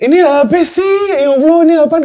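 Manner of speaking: high, loud mock whine; childlike complaining cry. Survivor re-enacting crying like a little kid, whiny wail. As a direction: wail it like a whining small child. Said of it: "Oh, kayak anak kecil ya?".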